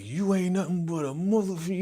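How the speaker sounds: high-pitched mock tirade; heated retold cussing; cursing him out. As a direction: imitate an angry man hurling insults. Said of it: "He started cursing him out".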